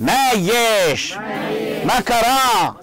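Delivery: slow, exaggerated amazement; astonishment. Shown as a demonstration. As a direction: model amazement for the class to repeat. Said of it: "باستغراب يعني".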